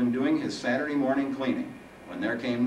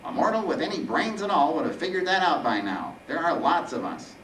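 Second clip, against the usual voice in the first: raised, whiny superiority; sarcastic Reaper; 8 semitones higher, 3 dB louder than usual. Acted. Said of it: "Sarcasm now tinged the Reaper's voice".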